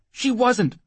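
high, anxious protest; pleading. Acted. Whoremaster denying he kept her, desperate pleading. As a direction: plead your case in a high, urgent voice. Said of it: "Sancho pleaded".

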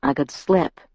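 understated, pensive remark; thoughtful. A narrator's reading. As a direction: say it as if mulling it over. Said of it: "His tone was thoughtful".

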